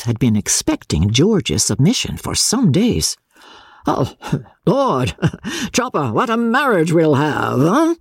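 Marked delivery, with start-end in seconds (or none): 3.9-7.9 high, laughing gloat; a father gloating to his clerk, high and laughing; gloating chuckle. Acted. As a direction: crow over the match with a chuckle. Osborne gloats over coming marriage.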